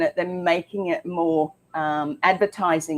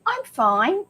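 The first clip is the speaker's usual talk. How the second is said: high-pitched, put-on insistence; mock denial; 10 semitones higher than usual. Host voicing someone stuck in their eating habits, mock denial.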